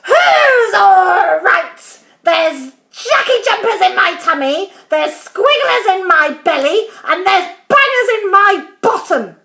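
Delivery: loud, high, indignant rant; Grandma yelling. A narrator's reading. Grandma complaining to George about her belly, indignant yelling. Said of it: "she yelled".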